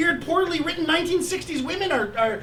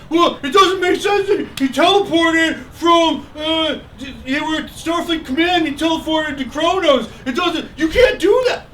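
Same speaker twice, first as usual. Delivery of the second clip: mocking whiny fan; 3 semitones higher, 5 dB louder than usual. To a fan who nitpicks teleporting, loud mock whine. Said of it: "remember when you're like".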